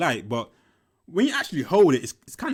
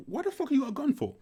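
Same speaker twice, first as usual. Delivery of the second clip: higher, alarmed "what the fuck"; shocked question; 11 semitones higher, 3 dB quieter than usual. Retold or imitated. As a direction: demand an answer in shock.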